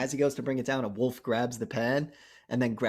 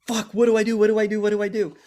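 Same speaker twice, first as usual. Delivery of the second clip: panicked; 9 semitones higher, 8 dB louder, faster than usual. Retold or imitated. Spoonie panicking, cornered by werewolf.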